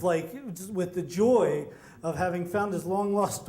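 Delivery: ordinary delivery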